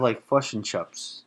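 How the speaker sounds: Fush and Chups with kiwi vowels; broad kiwi accent. Retold or imitated. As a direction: say it like a New Zealander. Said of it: "think of".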